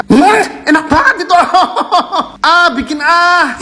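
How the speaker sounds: laughing. Real, not acted. Reacting to food, laughing.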